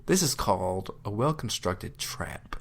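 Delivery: slow, hushed dramatic announcer; announcer reveal. Shown as a demonstration. Husband announcing to an imagined audience, slow and hushed. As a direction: present it to the crowd, slowly. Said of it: "ladies and gentlemen".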